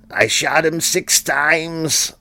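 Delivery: high, slow, drawn-out movie quote; mock distraught Loomis. Loomis after unloading the gun, mock distraught. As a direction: stretch it out in hammed-up disbelief.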